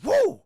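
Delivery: high, loud "woo"; short, piercing hyped yell; pumped-up whoop. Acted. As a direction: whoop with a sudden rush. Character pumped up by drug.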